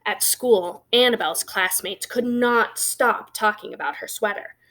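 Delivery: ordinary delivery, nothing marked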